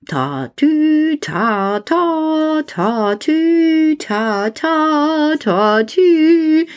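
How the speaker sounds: high sing-song siren ta tü; fire siren. Acted. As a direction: play a toy fire engine's siren. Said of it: "und macht". (hohe Feuerwehrsirene, singend)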